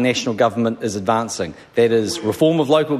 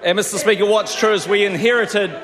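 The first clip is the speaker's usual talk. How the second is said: raised, shouting voice; 6 semitones higher than usual. Real, not acted. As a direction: shout over the noisy House. PM shouting over the House.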